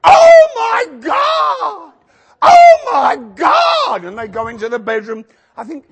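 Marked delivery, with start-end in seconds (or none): 0.0-4.0 piercingly high, very slow shriek; mimicked amazed screaming. Homeowners go into the lounge, mock ecstatic screaming. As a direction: wail it out in hammed-up amazement. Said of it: "go into the lounge and they go".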